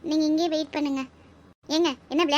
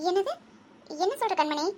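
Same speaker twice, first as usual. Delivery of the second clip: usual speaking voice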